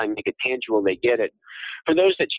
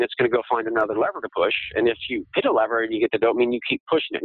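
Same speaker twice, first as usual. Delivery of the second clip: quick, rushed explanation; talking fast; faster than usual. Real, not acted. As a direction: hurry through the logic. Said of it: "I'm sorry I'm talking so fast".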